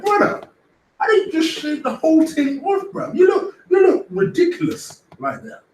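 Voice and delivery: high squeaky mock disbelief. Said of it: "You're like".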